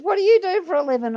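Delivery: high, loud cop question; mock interrogation. Cop questions the prisoner, high mock interrogation. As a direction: fire it off as an officer.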